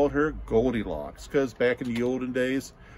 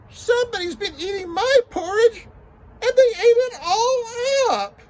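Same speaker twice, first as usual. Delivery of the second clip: put-on very high, loud, wailing baby bear; tiny crying baby bear; 8 dB louder than usual. Baby bear crying to his parents, high and wailing. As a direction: wail it like a heartbroken little cub. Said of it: "little bitty Baby Bear said".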